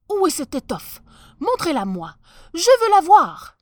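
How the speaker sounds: eager cry. Acted. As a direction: demand to see it at once. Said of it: "s'écria l'empereur".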